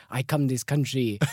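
put-on Italian with broken English; deliberately foreign-sounding Italian learner; amateur Italian accent. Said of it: "so it's a bit authentic".

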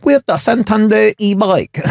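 high, drawn-out mock Thai accent; slow, lilting Thai-accented jingle; Thai accent attempt. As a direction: read the ad tagline in a put-on Thai accent. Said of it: "What, Thai accent?".

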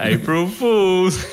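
high, drawn-out singsong gotcha; spring the joke slowly and high; mock prank reveal. Company revealing its prank to angry customers, mock gleeful.

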